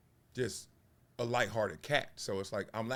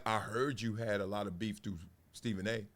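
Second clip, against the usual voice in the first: lower, softer Skip Bayless impression; imitating Skip; 7 semitones lower, 7 dB quieter than usual. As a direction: drop your voice like Skip digging. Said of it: "Skip be saying stuff like".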